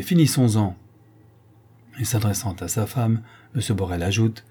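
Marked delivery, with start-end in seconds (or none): 0.0-0.8 pressé d'en finir, agacé; impatient. A narrator's reading. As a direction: trancher sèchement. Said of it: "dit impatiemment". (le banquier veut en finir, impatient)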